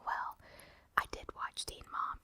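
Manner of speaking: normal talking voice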